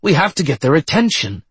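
high, urgent excitement; high-pitched, breathless-with-hope exclaiming; excited. A narrator's reading. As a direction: burst out eagerly, pitch way up. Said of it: "Doctor Zade continued excitedly".

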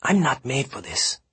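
high, hushed panicky protest; nervous protest. A narrator's reading. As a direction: protest anxiously under your breath. Gil panics in hushed exchange.

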